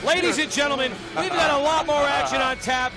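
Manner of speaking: normal talking voice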